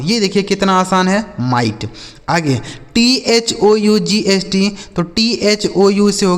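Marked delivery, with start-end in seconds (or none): 1.4-1.9 "माइट" as mai plus t, gh silent; lower, falling "माइट" ending on t; model pronunciation. Shown as a demonstration. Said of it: "लिखा माइट". ("माइट", अंत में केवल ट)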